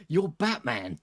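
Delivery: giggling before and after the words; tickled, breaking up with real laughter; laughing. Real, not acted. Streamer teasing a viewer about the sound file, laughing. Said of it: "I'm laughing because".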